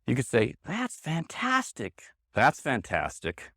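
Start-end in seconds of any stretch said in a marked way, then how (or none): 0.6-2.0 high, slow, delighted; sincerely thrilled, pitched well up; genuine praise. Shown as a demonstration. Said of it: "one is genuine and one is sarcastic". 2.3-3.5 low, slow, unimpressed; mock praise in a low voice; sarcastic. Shown as a demonstration. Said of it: "one is genuine and one is sarcastic".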